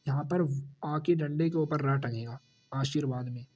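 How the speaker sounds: ordinary talk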